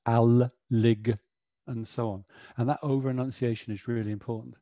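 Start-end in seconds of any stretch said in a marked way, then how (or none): none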